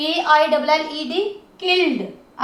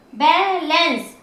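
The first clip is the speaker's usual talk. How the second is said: Balance slowly, as बै and lens; slow, segmented balance; syllable by syllable; slower than usual. Shown as a demonstration. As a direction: draw out both parts of balance. Teacher splits word into syllables.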